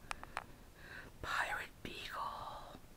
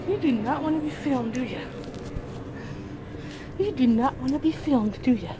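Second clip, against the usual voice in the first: loud, higher teasing scold; mock scolding; 3 semitones higher, 8 dB louder, faster than usual. Real, not acted. Owner teasing the dog who hates filming, loudly. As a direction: tease her like a stern parent.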